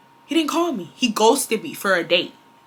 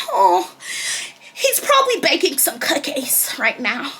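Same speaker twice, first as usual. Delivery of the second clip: put-on airy, higher voice of a girl who suspects nothing; naive trusting girl; 4 semitones higher than usual. Mocking the trusting girlfriend, higher and sweeter. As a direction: play the clueless girlfriend, sweetly. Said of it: "them girls that be like".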